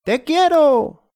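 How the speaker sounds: very high, slow shouted declaration of love. Acted. Eduardo shouting his love up at Julia, high and slow. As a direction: belt it up high and slow to her. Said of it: "y gritó".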